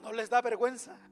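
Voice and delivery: loud mock reproach; acted scolding. David imagined shaming the men left behind, loud scolding.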